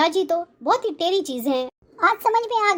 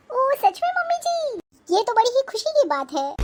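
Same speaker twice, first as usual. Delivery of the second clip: mock delight; 6 semitones higher than usual. Daughter-in-law echoes mother-in-law's words, mock delight. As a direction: parrot her joy back with sarcasm.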